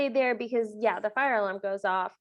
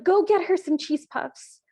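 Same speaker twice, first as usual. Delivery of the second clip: higher, louder mock stepmother order; bossy stepmother; 7 semitones higher, 6 dB louder than usual. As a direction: imitate a stepmother ordering someone about, pitched up. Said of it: "what the stepmother says".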